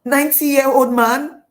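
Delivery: loud, high, rising disbelief; acted shock, ending on a rise; incredulous question. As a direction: question it loudly as if appalled. Acting Hagar's disbelief at match.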